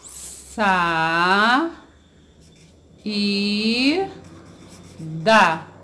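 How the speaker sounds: SAÍDA as three drawn-out pieces, SA, I, DA; syllable by syllable. Shown as a demonstration. Teacher showing how to separate a word, syllable by syllable. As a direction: pause after each syllable of "Sa, i, da". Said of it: "Como eu separo a palavra saída".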